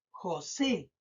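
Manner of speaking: José with SE held and stressed. Shown as a demonstration. Teacher showing pupils the tonic syllable, SE stressed in José. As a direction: lean on the final SE of José. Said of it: "no marco más la voz en jo".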